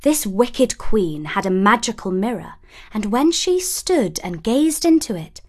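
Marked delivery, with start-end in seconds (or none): none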